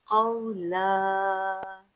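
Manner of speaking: slow chanted verse with long vowels; tajweed recitation. Shown as a demonstration. Teacher reciting the verse for students, slow melodic chant. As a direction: chant each word slowly and melodiously. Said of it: "मद्दे आरिज़ करेंगे".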